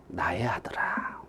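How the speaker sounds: slow, hushed, low murmur; very soft fatherly call; tender father. Acted. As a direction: soothe the son almost in a whisper. Father answers his angry son.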